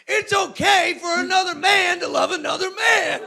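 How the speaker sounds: high hollered proclamation; street shouter. Retold or imitated. Man down the street preaching to strangers, hollering.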